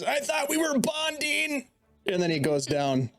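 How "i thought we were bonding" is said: high, slow wounded goblin; squeaky, hurt goblin. Acted. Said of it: "He just looks at you and says".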